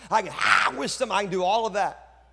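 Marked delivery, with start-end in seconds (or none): 0.1-1.1 sudden loud preacher's outburst; preaching demo. Shown as a demonstration. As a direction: break into a burst of preaching. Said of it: "I can preach".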